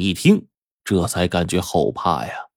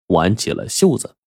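plain reading voice

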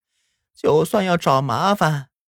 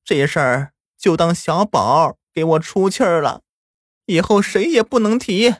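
tearful; 8 semitones higher than usual. A narrator's reading. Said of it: "奶奶却流着眼泪说道".

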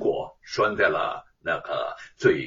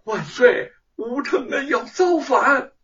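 tearful complaint; 11 semitones higher, 4 dB louder than usual. A narrator's reading. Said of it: "哭诉道".

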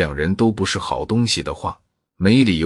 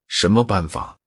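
a mildly puzzled question. A narrator's reading. Zhou Siping asks Dakang about his method, mildly puzzled. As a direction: ask it with a touch of doubt. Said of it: "疑惑地说".

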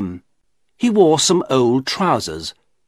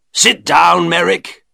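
raised-voice shopkeeper's order; sharp command; 6 semitones higher, 6 dB louder, slower than usual. A narrator's reading. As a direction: order him down, loud and high. Shopkeeper orders Merrick to sit.

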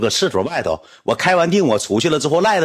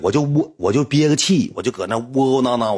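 ordinary talk

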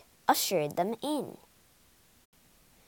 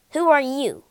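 demanding; 5 semitones higher, 7 dB louder, faster than usual. Acted. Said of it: "demanded the Tin Man".

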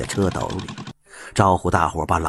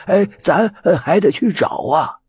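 put-on high, rambling old-man voice; a dazed old man muttering about his lost child; confused old man; 11 semitones higher, 3 dB louder than usual. A narrator's reading. (糊涂老头絮絮叨叨)

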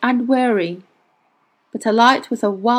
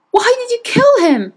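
high, loud demand for an answer; angry shout; 14 semitones higher, 5 dB louder than usual. A narrator's reading. Will demanding why the witch killed the man, angry shout. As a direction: cry out the accusation at high pitch. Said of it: "he shouted".

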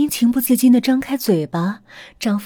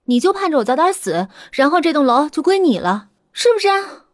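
higher, taunting and harsh; bitter accusation; 7 semitones higher, 3 dB louder than usual. Acted. Wife accuses husband of wanting her building, angry. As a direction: jab at him with each question.

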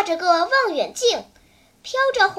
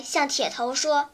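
usual speaking voice

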